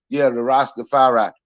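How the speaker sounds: low, laid-back one-love voice; lazy, low Rasta guy preaching love; mock Rasta dude. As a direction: imitate a mellow corner-store Rasta, pitched low. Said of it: "It's a dude I used to see at the corner store".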